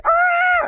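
high, mock fangirl squeal; giddy squeal. Husband imitating his wife's giddy movie reaction, mock squeal. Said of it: "she was, uh, literally giddy, like".

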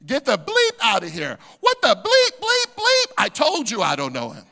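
loud outburst, bleeps shooting up high; angry denial. Acted. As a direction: act out an exasperated, swearing denial. Acting out Peter cursing and denying Jesus.

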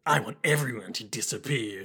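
low, menacing villain voice; a low, cold threat from an unhinged villain; evil voice. Retold or imitated. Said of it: "It's my evil voice".

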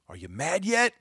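sharp rhetorical jab at viewers. Real, not acted. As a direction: fling the question upward. Repeated challenge, high take.

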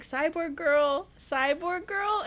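slow, high singsong call. Retold or imitated. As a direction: call her name like a tease. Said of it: "he's like".

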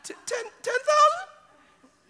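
squeaky high stammering crack. Retold or imitated. Preacher hearing of the huge check, voice cracking high. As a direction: let the voice break upward in disbelief. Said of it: "my voice cracked all of a sudden".

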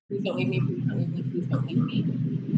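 breathless whisper, begging them not to go; needy pleading whisper. Retold or imitated. To a partner she fears will leave, a pleading whisper. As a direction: whisper it like a clingy plea. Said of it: "because I just".

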